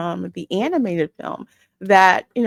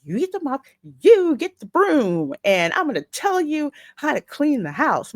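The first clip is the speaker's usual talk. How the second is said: high-pitched mock bossy instructions; lightly acted, higher-pitched ordering about; telling them what to do; 7 semitones higher than usual. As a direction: imitate someone handing out chores, stressing each "you". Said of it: "telling them".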